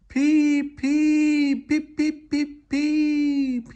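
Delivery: high, loud shrieking peeps; ghostly whistle shriek. Acted. Percy gliding through the shed to scare Thomas, shrieking. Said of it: "he shrieked".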